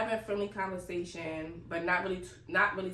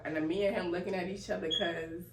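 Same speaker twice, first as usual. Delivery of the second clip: ordinary talk, nothing marked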